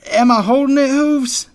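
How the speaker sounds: loud, high, pained strain; through gritted teeth. A narrator's reading. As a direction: force it out in pain, teeth clenched. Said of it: "He winced through gritted teeth".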